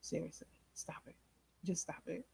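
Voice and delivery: fast hushed whisper; hushed plea. Retold or imitated. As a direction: urgently whisper him to stop. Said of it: "I'm like".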